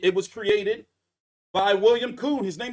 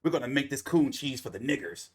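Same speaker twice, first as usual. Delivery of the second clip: lower, mocking, with a fake laugh; 7 semitones lower than usual. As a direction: sneer it like a cartoon bigot. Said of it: "some angry racist somewhere said".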